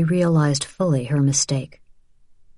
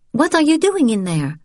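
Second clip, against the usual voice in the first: sharp, higher demand from the sister; 9 semitones higher than usual. Acted. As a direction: call her sister out. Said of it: "Isabel demanded, her voice too loud".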